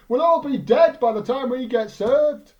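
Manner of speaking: loud, high, slow mock grumble; loud joking complaint. Derby Boy joking loudly to the absent owner. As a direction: call it out so the whole shop hears. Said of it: "so Derby Boy yells out".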